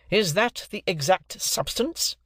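high, slow, pointed question; bitter. A narrator's reading. Stranger presses Wopsle on the substance, bitter. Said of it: "repeated the gentleman bitterly".

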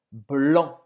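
blanc with no C sound at the end, nasal vowel closing; blanc, sem pronunciar o C; silent final c. Shown as a demonstration. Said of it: "Você não vai pronunciar esse C no final".

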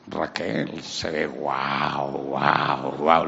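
very slow, low barking "guau"; drawn-out low dog-like "guau guau guau"; barking wow. Acted. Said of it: "Lo puso a ladrar".

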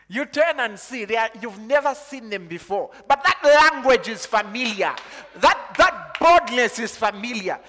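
loud, high preaching, ringing out over the congregation; full-force preaching. Acted.

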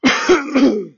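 loud, hacking real cough; throat-clearing cough. Real, not acted. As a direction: cough hard to shift the phlegm. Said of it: "get it all out".

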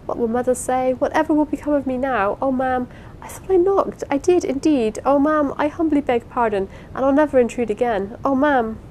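high-pitched, flustered apology; distracted pleading. A narrator's reading. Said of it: "addressing herself distractedly to the ceiling".

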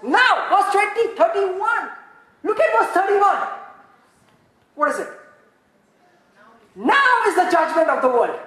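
high, loud and slow preaching; impassioned sermon climax; fervent slow preaching. Acted. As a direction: lean on every word for the congregation. Preaching at full force.

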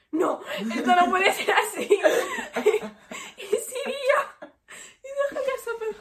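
high, loud, laughing through the words; can't get it out for laughing; laughing hard. Real, not acted. Acting out Nietzsche, laughing hard. (riendo sin poder parar)

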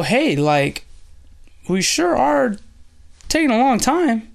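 high-pitched, awkward hint to a friend; hesitant nudging. Retold or imitated. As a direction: gently needle him, voice lifting high. Disciples nudging Jesus, acted out.

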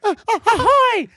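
very high, squeaky cartoon mouse voice; high squeaky mouse greeting; Mickey Mouse voice. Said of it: "my Mickey Mouse voice".

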